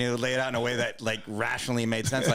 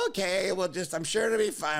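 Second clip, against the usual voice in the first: high, airy brush-off; mock her casual unconcern, pitched high; breezy dismissal; 9 semitones higher than usual. Sober girlfriend brushing off his panic on the phone, high and breezy.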